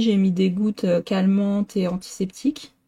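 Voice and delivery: normal talking voice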